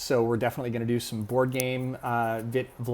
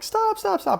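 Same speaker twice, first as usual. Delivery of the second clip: very high imitation of a frantic mother; high-pitched, louder mock alarm; panicked mom; 5 dB louder, slower than usual. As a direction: mimic mom urgently calling a halt. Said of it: "runs up to me, and she's like".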